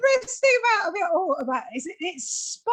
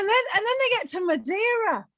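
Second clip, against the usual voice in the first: ordinary talk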